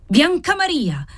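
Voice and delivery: high, slow cry of the name; an old father crying out his daughter's name; exasperated shout. A narrator's reading. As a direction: call her in exasperation. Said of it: "gridò l'esasperato vecchio".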